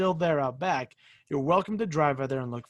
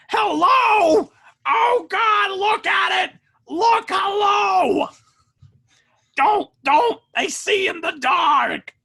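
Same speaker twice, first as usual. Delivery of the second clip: high, loud, screechy wailing shouts; Gilbert Gottfried; 7 dB louder, much slower than usual. Retold or imitated. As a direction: shriek it out like a frantic ghost. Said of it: "That's Gilbert Gottfried".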